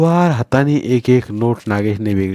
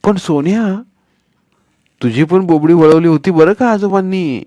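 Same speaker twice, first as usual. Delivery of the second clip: put-on raised, playful boy's voice; teasing boy; 7 semitones higher, 4 dB louder than usual. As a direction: tease your friend with a grin. Child character teasing friend.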